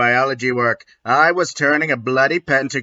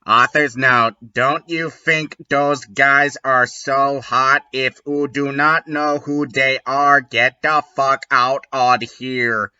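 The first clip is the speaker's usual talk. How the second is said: usual speaking voice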